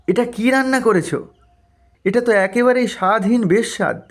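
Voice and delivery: a touch of an annoyed husband; disgusted husband. Acted. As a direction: spit out your disgust at the bland dish. Husband tastes bland fish.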